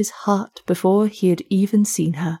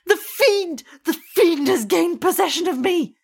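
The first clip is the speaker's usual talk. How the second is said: much higher, frantic shriek without much volume; shrieking in terror; 10 semitones higher than usual. Acted. Student in a frenzy, high terrified shriek. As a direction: cry out in horror as if possessed. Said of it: "shrieked he".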